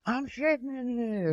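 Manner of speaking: put-on high voice. Voicing man in bathroom.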